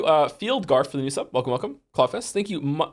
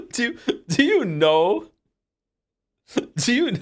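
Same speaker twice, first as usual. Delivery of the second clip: laughing; 8 semitones higher, 4 dB louder, slower than usual. Real, not acted. Laughing at the clip reveal.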